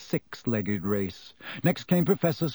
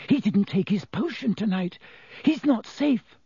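put-on high, frightened girl's warning; gasping alarm; 8 semitones higher than usual. A narrator's reading. Hermione warning that Lupin is unsafe, alarmed gasp. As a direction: warn them, pitch climbing in panic. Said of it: "Hermione gasped".

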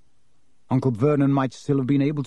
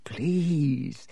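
slow, desperate begging; hushed, fearful croak; croaked plea; 4 semitones higher, 4 dB quieter, much slower than usual. Acted. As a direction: beg weakly, dragging out the words. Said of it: "croaked Harry".

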